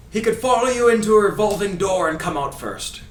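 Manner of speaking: put-on high, loud Scottish commentator accent; Ray Hudson accent. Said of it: "do my best Ray Hudson accent".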